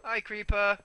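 high, loud greeting; calling out. Pretend. Gamer greeting a creeper, loud high call. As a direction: call over to it loudly.

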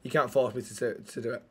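very low, soft sulk; mumbly petulant refusal, dropping away; sulky refusal. Acted. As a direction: refuse under your breath, sulking.

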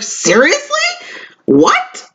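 shocked, squeaky high disbelief; incredulous retort. Retold or imitated. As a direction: act out being baffled by the insult. Retelling her reaction to coworker.

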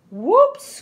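high, loud yelp; startled cry. A narrator's reading. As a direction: yelp as you lose your balance. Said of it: "cried Clara".

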